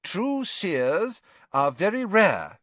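high, measured teacher voice; controlled disapproval, weighing the key words. A narrator's reading. Teacher telling class her view of divination, controlled disapproval. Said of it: "She went on more calmly".

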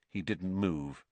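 ordinary delivery

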